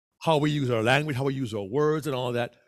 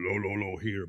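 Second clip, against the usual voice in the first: dropped lower and softer; mock low voice; 8 semitones lower, 5 dB quieter than usual. Shown as a demonstration. Speech coach mocks an overdone low character voice. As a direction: sink the voice down low. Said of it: "I don't mean you have to go".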